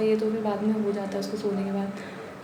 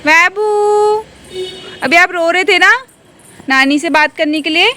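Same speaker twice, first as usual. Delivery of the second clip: high, loud sing-song cooing; 8 semitones higher, 12 dB louder than usual. Real, not acted. Mother cooing at the baby who cried for grandma, high sing-song. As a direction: coo up at the little one.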